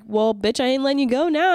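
playful excited. Retold or imitated. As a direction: gleefully claim the friendship. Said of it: "I was like".

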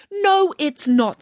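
high, slow, insistent refusal; indignant and emphatic; firm contradiction. A narrator's reading. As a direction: contradict firmly, drawing out each word. Nanny flatly contradicts Samantha.

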